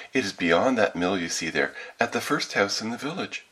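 put-on little girl. A narrator's reading. Child telling the wolf where grandmother lives, little-girl voice. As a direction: give directions as an artless little girl.

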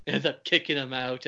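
ordinary talk, nothing marked

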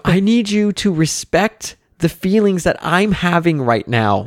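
earnest daughter lecturing parent; kid counselor voice. Retold or imitated. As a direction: impersonate a little girl sounding like a counselor. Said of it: "this kid's like a counselor".

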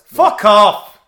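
forceful shout. Shown as a demonstration. Co-host demonstrating the middle finger on request, forceful shout. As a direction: shout it hard and sudden.

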